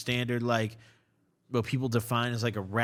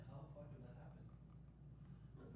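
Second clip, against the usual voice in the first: whispering; 29 dB quieter, much slower than usual. Real, not acted. Shocked by the sudden glitch.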